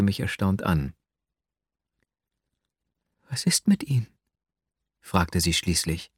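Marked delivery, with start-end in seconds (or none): 3.3-4.1 tonlos geflüsterte Frage; erstauntes Flüstern. Acted. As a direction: frag verwundert im Flüsterton. Sie fragt erstaunt nach langem Schweigen. (erstaunt geflüstert)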